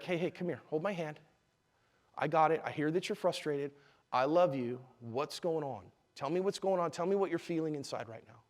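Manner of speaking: put-on soft, reassuring parent to a child; real quiet. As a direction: talk a screaming child down quietly. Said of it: "if I could say that real quiet".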